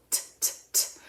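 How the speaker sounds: whispered tapping t, t, t; light tongue-tip t, t, t; t sound. Shown as a demonstration. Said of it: "at the front of the mouth with the tongue up".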